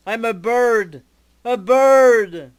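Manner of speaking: rising to a shriek at the last words; very upset. Acted. Jazz yells at E-Man after being turned into a bird. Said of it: "Jazz is very upset".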